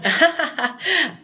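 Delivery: short, high burst of giggles; real laugh at oneself; amused laugh. Real, not acted. Teacher catching a mistake in the class example, laughing.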